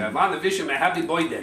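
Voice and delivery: Louie. Retold or imitated. Jerry's father at the birthday party, thick foreign accent. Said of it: "Louie has a very thick accent".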